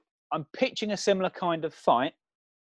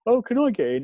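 pitched up much higher and louder, a mock hopeful ask; high cheeky voice; 9 semitones higher, 4 dB louder than usual. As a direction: play a cheeky intruder asking to join. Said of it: "and then you hear".

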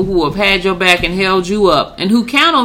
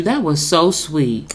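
normal talking voice

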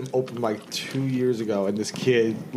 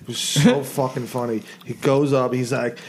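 ordinary talk, nothing marked

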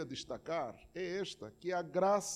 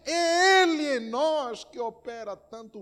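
louder, higher, impassioned preaching building to a cry; fiery preaching; 8 semitones higher, 11 dB louder than usual. Acted. Pastor urging the congregation about prayer, impassioned. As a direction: preach it at full force.

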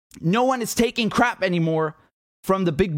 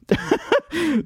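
a short, high, amused laugh; real chuckle; 10 semitones higher than usual. Real, not acted. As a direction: burst out laughing at the joke. Laughing at the clip.

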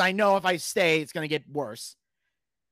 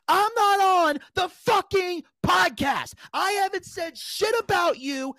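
high-pitched, indignant shouting; retold angry yell, stretched at the start; mad outburst; 11 semitones higher, slower than usual. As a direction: go off on him, voice way up. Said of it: "I was so mad".

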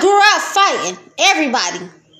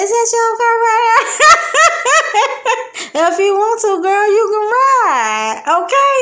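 put-on quoted girl; 7 semitones higher, 3 dB louder than usual. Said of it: "She said".

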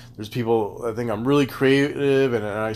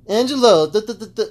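mocking ranter; 7 semitones higher, 4 dB louder than usual. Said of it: "people who will be like".

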